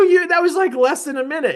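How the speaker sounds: high, loud protest that fades at the end; disbelieving high-pitched complaint; incredulous protest. Retold or imitated. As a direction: argue that it took no time at all. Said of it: "I go".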